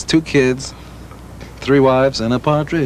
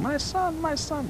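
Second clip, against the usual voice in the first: put-on much higher, soft Hungarian-accented mother crying out; 9 dB quieter, slower than usual. Gene imitates his Hungarian mother greeting the band, high-pitched. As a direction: call out like a proud Hungarian mother. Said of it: "She's Hungarian, that accent he put on".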